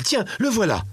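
put-on high-pitched boy pointing someone out; child's cry. A narrator's reading. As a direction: call it out like an excited child. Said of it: "un cri de l'enfant".

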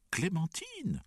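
high-pitched exclamation of the name; delighted greeting. A narrator's reading. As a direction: greet a newcomer with a high cry. Delighted greetings at family reunion. (salutation ravie, voix très aiguë)

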